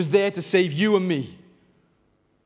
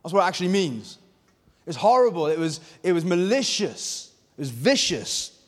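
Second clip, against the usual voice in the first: ordinary talk, nothing marked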